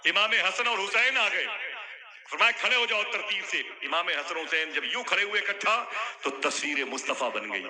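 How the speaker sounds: high, loud fervent sermon climax; preaching at full force. Acted. Preacher bringing the story to its climax, shouting. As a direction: build the crowd to a fervent peak.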